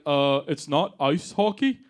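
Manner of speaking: low, slow Canadian correction; Canadian accent. Acted. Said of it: "They're always like".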